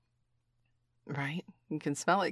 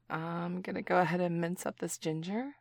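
usual speaking voice

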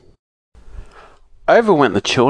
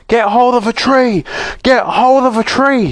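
much higher, louder, far-off crowd; shouting warning; 12 semitones higher, 3 dB louder than usual. A narrator's reading. Rocking Land folk warning everyone, shouting. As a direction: cry the alarm. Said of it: "shouted the people round about".

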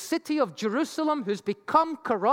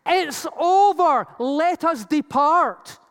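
slow, loud, higher proclamation; 3 semitones higher, 4 dB louder, slower than usual. Retold or imitated. Preacher voicing the voice in the temple, slow and loud. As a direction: announce it slowly like a voice from the inner courts. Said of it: "heard a loud voice from the inner courts".

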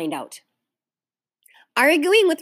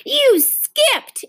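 high, loud accusing cry; high-pitched child butting in; interrupting; 5 dB louder than usual. A narrator's reading. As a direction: cut in sharply with an accusation. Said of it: "interrupted Ramona".

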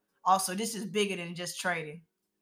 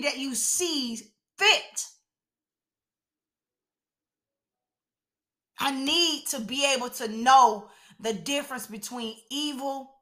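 deliberate, emphatic petition with long pauses; preacher voicing a biblical prayer; earnest prayer; 4 semitones higher than usual. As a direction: plead the request slowly and firmly. Voices Solomon's prayer in own words.

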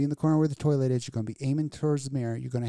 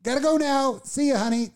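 higher and louder, a sing-song sneaking-off voice; hurried exit; 9 semitones higher, 3 dB louder than usual. Acted. Pitching a scene to cohost, sing-song caught-out exit. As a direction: play it like you're caught and slipping out. Acted quote fleeing the scene.

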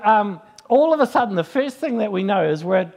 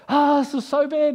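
panicked cry; 8 semitones higher than usual. Retold or imitated. Panicking passenger.

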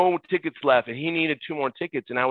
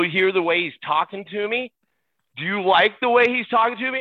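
mock indignation; 6 semitones higher than usual. Acted indignant reply to teammate.

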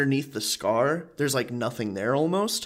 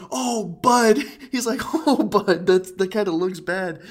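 raised, louder mock neighbor voice; a mock shocked neighbor, pitched up; imitating neighbor; 7 semitones higher, 5 dB louder than usual. Neighbor reacting to injured kid.

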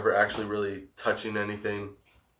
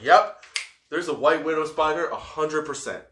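confident; 6 semitones higher, 5 dB louder than usual. Retold or imitated. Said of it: "I would've been like".

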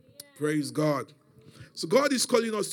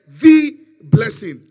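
"the" boomed as a long "thee"; stress on "the"; 17 dB louder, much slower than usual. Retold or imitated. Father explains the unique gift to his son, booming "the". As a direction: stretch "the" slowly at full volume. Contrasts it with a blessing.

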